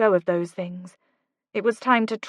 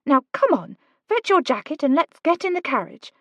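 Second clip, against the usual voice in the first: put-on lightly higher, urgent young woman; Kate's voice; 7 semitones higher than usual. A narrator's reading. Kate urging Oliver to go, lightly higher voice. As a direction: push him, brisk and bright.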